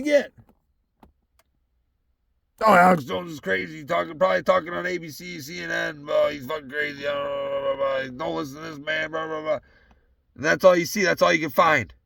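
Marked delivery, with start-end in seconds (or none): 2.6-9.6 mocking media voice. Mocking the media on Alex Jones.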